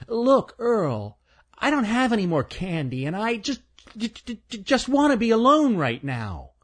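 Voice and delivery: high, stammering squirrel voice; a timid squirrel tripping on words; nervous stuttering squirrel. Acted. Stanley's voice.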